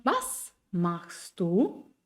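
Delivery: very slow, Was stressed and a long rise on du; rising question tune. Shown as a demonstration. Teacher models a German question for Spanish learners, slow rise. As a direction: draw out Was machst du, climbing. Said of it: "Es fängt schon".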